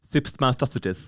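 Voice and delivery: slow lisped Thips my sausages, s as th. Shown as a demonstration. Performing a lisp on request, slow and lisped. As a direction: lisp every s, slowly. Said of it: "Yeah, go on".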